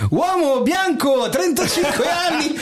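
high, drawn-out paramedic's announcement; hammed-up emergency call, breaking into laughter; mock urgent yell. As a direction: imitate an overdramatic rookie bursting in. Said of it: "entrando si è messo a urlare".

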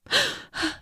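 high breathless gasp; gasp for air. Acted. Woman gasps for air.

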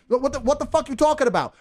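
normal talking voice

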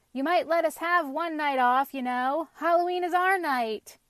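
loud, high wheedling appeal; persuasive. A narrator's reading. Pumpkin asking for one night off, persuasive appeal. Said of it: "began Pumpkin in a persuasive voice".

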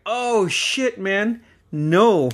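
high, startled exclamation; startled oh shit. Retold or imitated. Recalling his own alarm, a high startled swear. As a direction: caught off guard and blurting it out.

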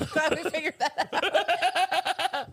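says it through laughter; slow, laughing words. Real, not acted. Laughing wrap-up.

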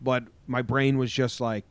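ordinary talk

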